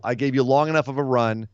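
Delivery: usual speaking voice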